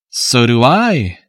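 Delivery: slow, dry sarcastic reply; mocking agreement, drawn out. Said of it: "answered the policeman in the same tone".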